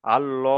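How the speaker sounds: আল-ল with a full, rounded "lo"; heavy "al-LO" on আল-ল; round-mouthed pronunciation. Shown as a demonstration. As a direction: round the lips for the ল in আল-ল. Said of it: "তখন মুখটা গোল হয়ে যাচ্ছে".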